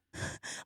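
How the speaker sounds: breathy demonstration pant; imitated panting. Performer showing how winded the dance break leaves her, mock panting. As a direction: puff air out as if winded. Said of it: "like, panting".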